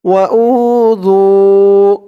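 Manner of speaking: slow chanted tajweed reading; Quran recitation. Acted. Teacher reciting the verse for students to follow. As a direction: draw out فالذين هاجروا melodically.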